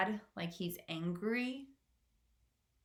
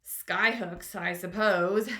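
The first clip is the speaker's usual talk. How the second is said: mocking, sarcastic jeer; 8 dB louder, slower than usual. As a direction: scoff at the idea. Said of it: "jeered the centipede".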